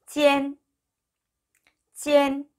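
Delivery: 찌엔 high, level and drawn out; teacher's sustained 찌엔; model pronunciation. Shown as a demonstration. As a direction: sound out 찌엔 on one held high note. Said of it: "이라고 읽으시면 되십니다".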